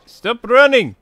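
put-on high, rising calls in Russian accent. Ordering someone to stop running, high Russian accent. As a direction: call after a runner as a mock Russian. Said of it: "I just happen to like Russian accent".

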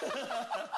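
high imitated dog snarl; dog growl. Dazed Rottweiler answering man who steps forward, growling. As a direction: growl back like a riled dog. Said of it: "And he goes".

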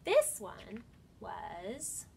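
animated storybook voice with dramatic pauses; reading to children. Acted. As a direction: read it like story time, building suspense. Reads picture book to children.

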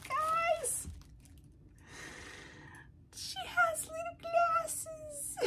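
high, slow, near-tearful squeal of delight; excited squeal. Real, not acted. Unboxing a gift from Gwen, high squealing delight. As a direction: gush over the tiny glasses like they are the cutest thing ever. Squealing over tiny doll glasses.

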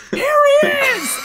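very high, very loud shout; imitating a friend shouting across campus; yell. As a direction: scream it out to the others. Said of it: "So Elijah just yells".

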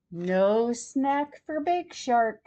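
ordinary delivery, nothing marked

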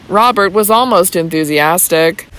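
ordinary delivery

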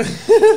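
loud high laugh; cracking up loudly; burst of laughter. Real, not acted.

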